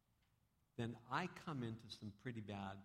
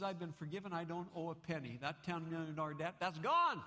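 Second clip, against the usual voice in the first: higher, excited telling; elated; 6 semitones higher, 5 dB louder than usual. Acted. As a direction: burst with joy at the news. Man tells wife, elated.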